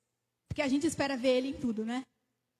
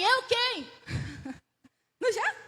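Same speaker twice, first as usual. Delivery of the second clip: higher, squeaky mock-wary "Eu quem?"; mock suspicious; 7 semitones higher, slower than usual. Alone at night answering a knock, hammed-up suspicious "Eu quem?". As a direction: act out a jumpy "Eu quem?" at the door. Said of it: "e você fala assim".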